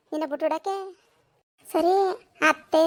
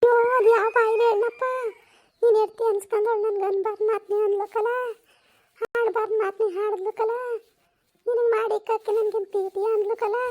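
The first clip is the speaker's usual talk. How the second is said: high-pitched, hammed-up crying complaint; tearful wailing; 6 semitones higher than usual. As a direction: wail to your son as the wronged mother-in-law. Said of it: "ಯಾಕೆ ಅಳ್ತಿದ್ದಿ". (ಗೋಳಾಡುತ್ತಾ ಅಳುವ ದೂರು)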